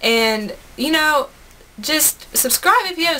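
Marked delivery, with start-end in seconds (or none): none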